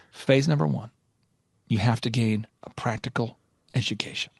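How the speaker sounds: low, deliberate mentor imitation; theatrical guru, slow and low; slow-talking Michael Levine. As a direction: space it out with weighty pauses. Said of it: "he's going kinda slow".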